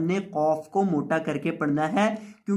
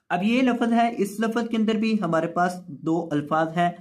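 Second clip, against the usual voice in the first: normal talking voice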